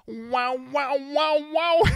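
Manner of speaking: rising high mock wah vocal; hammed-up wah-wah wail; mock wah-wah sound. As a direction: imitate a guitar wah going up. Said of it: "the next sound I hear is".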